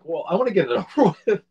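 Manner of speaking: loud, fast, laughing reply; laughing through it. Retold or imitated. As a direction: laugh while brushing it off. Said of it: "I was like".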